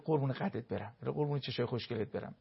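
put-on mother praising her visual child softly; tender endearment. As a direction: coo over the child lovingly. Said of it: "هی بگو".

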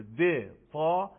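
د and تا very slow, drawn apart; slow teacher contrast of د and تا; slow demo of sounds. Shown as a demonstration. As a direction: pull د and تا apart, slowly. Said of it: "د هم همین مخرج رو داره".

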